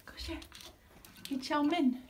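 ordinary talk

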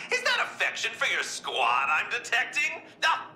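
usual speaking voice

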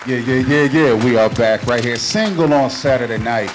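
normal talking voice

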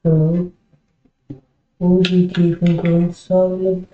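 ordinary talk, nothing marked

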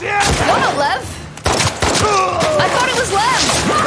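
high, slow, stretched-out yell; alarmed realisation. Real, not acted. Streamer realising the figure isn't Lev, alarmed yell. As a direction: wail it as the mistake dawns. Mistook an enemy for ally.

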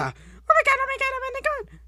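very high squeaky panic of excitement; squealed astonishment. Acted. Player stunned by a reveal, squealed astonishment. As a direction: squeal it in a rapid string.

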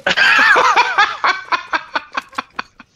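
long high-pitched laughing fit; helpless laughter. Real, not acted. Player cracking up at the innocent-sounding stranger, helpless laughter. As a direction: crack up, high laugh breaking into short bursts. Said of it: "this is killing me".

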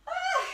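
a high, sharp cry of relief; gasp of exhaustion. Real, not acted. Coach finishing the cardio burst with a relieved high cry. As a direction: release the effort with a yelp.

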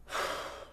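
long breathy sigh before the news; heavy sigh. Acted. Uncle's associate stalling before bad news, sighing. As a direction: sigh before getting to the point. Breaking awkward news to relatives.

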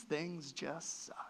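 soft breathy whisper, slow; hushed confession to the congregation; whispered aside. Real, not acted. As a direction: lean in and whisper it. Sermon aside, unvoiced.

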